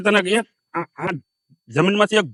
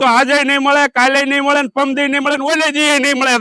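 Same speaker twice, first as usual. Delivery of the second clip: raised angry brother's rebuke; angry scolding; 7 semitones higher than usual. Acted. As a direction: throw it at him, furious. Angry elder brother scolding younger.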